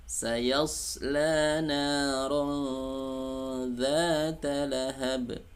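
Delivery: slow, high chanted recitation; Quran recitation. Shown as a demonstration. Teacher recites the verse for students.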